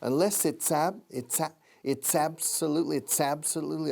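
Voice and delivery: "it's absolutely" joined with a ts sound; linking demo. Shown as a demonstration. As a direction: link "it's" straight into "absolutely" with ts. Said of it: "listen to the linking".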